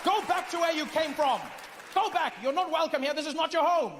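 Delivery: high, hammed-up British outrage; mock British nationalist protest; indignant British complainer. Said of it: "British people are complaining".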